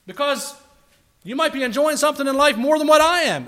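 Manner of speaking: loud, high, bitter complaint; miserable critic. Pretend. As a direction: sneer at the joy of others.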